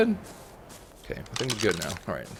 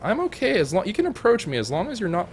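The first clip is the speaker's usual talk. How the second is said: much higher, delighted rambling; high thrilled reaction to the level; high excited chatter; 11 semitones higher, 6 dB louder than usual. Real, not acted. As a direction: gush at the find.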